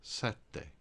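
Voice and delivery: sette with held double t, SET-te; Italian double t geminated; Italian double consonant. Shown as a demonstration. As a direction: repeat sette holding the tt. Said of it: "one of the consonants at the end".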